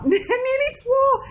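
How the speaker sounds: laughing. Real, not acted. Nearly swears, breaks into laughter.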